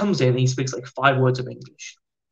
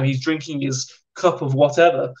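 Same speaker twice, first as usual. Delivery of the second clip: ordinary delivery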